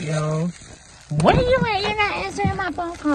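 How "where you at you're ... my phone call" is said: high, fast mock scolding; imitating a wife who cusses him out; mock angry wife. Wife scolding husband for missing her calls, mock angry. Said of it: "straight cussing me out".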